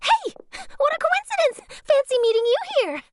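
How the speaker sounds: high, bright call across to a friend; excited greeting. Acted. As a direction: call out eagerly, pitched well up. Journalist spots someone, calls out.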